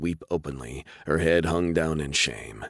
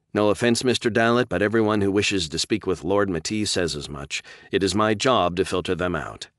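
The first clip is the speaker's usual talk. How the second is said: raised, formal brush-off; polite receptionist; 4 semitones higher than usual. Acted. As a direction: politely turn him away. Receptionist refuses again.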